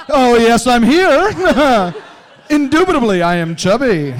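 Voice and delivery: high, loud, posh-mocking proclamation; hammed-up stately voice; pompous put-on voice. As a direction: announce yourself grandly, laughing. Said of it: "which is just like".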